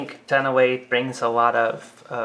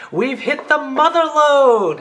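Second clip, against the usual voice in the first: very high, loud, slow triumphant cry; unbridled excitement; 14 semitones higher, 8 dB louder, slower than usual. Retold or imitated. Producer celebrating the ratings win, very high loud slow cry. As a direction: exult as if striking gold. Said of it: "delivers it with such unbridled excitement".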